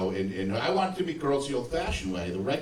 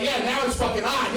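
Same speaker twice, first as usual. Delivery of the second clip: excited; 10 semitones higher than usual. Retold or imitated. Excited thought.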